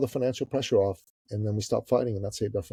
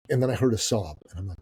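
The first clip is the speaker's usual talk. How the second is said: usual speaking voice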